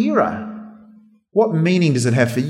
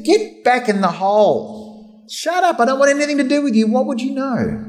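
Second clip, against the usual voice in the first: higher-pitched mock telling-off; dismissive sailors; 7 semitones higher than usual. Sailors telling prisoner Paul to shut up, mock scorn. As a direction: shoo away an unwanted adviser.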